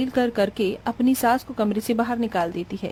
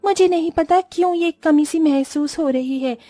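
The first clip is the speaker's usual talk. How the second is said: lightly pitched-up inner monologue; uneasy daughter-in-law; 5 semitones higher than usual. Acted. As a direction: wonder aloud what is missing, in the bride's voice. (बेचैन बहू का हल्का ऊँचा स्वर)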